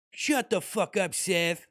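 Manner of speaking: Rick impression. Rick telling Seth to shut up, lightly voiced. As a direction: snap back like a grumpy old genius. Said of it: "Rick and Morty improv".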